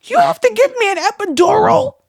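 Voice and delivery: high, loud, fast shocked question; shocked disbelief. Retold or imitated. Mother to doctor, stunned at needing an epidural. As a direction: blurt it out in disbelief.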